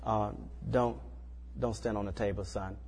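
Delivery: put-on gently but firmly tell the toddler no; a quiet parent setting a limit; calm firm correction. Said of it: "you can tell a person that".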